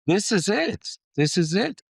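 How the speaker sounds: high, loud burst of delight; thrilled, saying it twice with rising pitch; excited. Real, not acted. To the interviewer about the land, high and excited.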